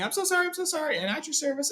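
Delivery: high, nervous hobbit apologizing to Thorin; flustered apology. Retold or imitated. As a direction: babble apologies in a panic. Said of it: "Bilbo is sitting there saying".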